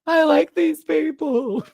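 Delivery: high, slow whiny protest; mock whine. Vlogger reacting to each elimination, high slow mock whine. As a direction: whine like a fan losing favorites. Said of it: "Every time someone goes home".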